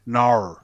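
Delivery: "nar." said with an open drawn vowel and an added R sound, Sydney style. Shown as a demonstration. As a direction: say "nar." like a broad Sydney local. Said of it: "When they say no".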